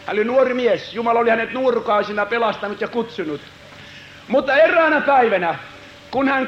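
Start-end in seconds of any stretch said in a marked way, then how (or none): none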